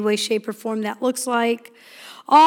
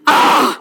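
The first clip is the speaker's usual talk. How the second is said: put-on loud exasperated teenage groan; 6 dB louder than usual. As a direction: groan back like a fed-up teen. Teen groans back.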